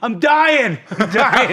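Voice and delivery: high, loud comic wail; laughing, hammed-up distress; mock dying cry. Retold wounded reply, laughing.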